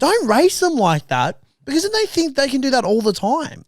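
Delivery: high, emphatic mock telling-off; exasperated scolding. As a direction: plead loudly with clueless parents. Scolding indulgent parents.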